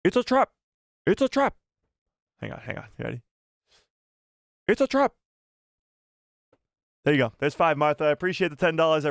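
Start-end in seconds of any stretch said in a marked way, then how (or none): 0.0-1.5 high, urgent alien commander warning; Admiral Ackbar impression. Streamer doing a fifth impression for a donor, high Ackbar voice. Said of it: "Let's do some Akbar". 4.7-5.1 high alien commander raising the alarm; high, urgent creature warning; Admiral Ackbar impression. Streamer retrying an impression for a donor, high Ackbar voice. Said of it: "Let's do some Akbar".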